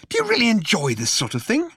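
high, curious query; spring the question suddenly. A narrator's reading. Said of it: "he asked suddenly".

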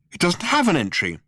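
Arthur blurts it out high; bursting out. A narrator's reading. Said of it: "he burst out".

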